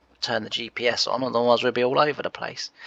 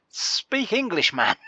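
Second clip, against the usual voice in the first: high, slow exasperation; drawn-out disbelieving jab; exasperated mock plea; 8 semitones higher, slower than usual. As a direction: plead in mock despair. Mocking the friend's odd word.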